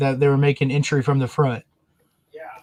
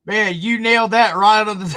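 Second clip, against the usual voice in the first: excited; 7 semitones higher, 5 dB louder than usual. Real, not acted. Cheering the suspect's comeback.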